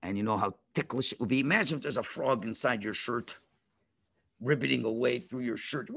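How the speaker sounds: plain reading voice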